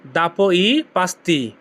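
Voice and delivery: "দাপো ই পাস্তি" said slowly as one whole phrase; slow, careful teacher's model of the phrase; slow Italian demo. Shown as a demonstration. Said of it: "এর Italian কি হবে?".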